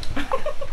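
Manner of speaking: high laugh. Real, not acted. Laughing at a joke.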